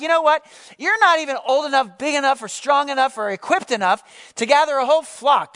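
furious big brother. Acted. Eliab berating David for coming to camp, loud scolding. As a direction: tear into the kid brother. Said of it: "was furious".

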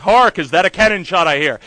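loud, ringing theatrical delivery of the line; rehearsing a stage line. Retold or imitated. Actor rehearsing in the shower, loudly proclaiming. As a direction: belt it like a musketeer captain.

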